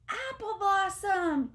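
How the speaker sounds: high, loud, slow call of her name; joyful high shout of a name. A narrator's reading. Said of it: "he shouts".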